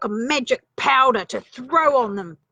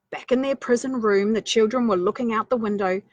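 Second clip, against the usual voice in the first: plain reading voice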